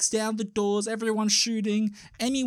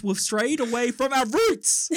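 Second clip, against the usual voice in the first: slow, raised, hammed-up complaint; mock lament; 5 semitones higher, slower than usual. Host voicing fans upset at tamer dreams, mock lament. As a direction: wail it with comic melodrama.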